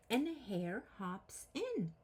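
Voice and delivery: ordinary delivery, nothing marked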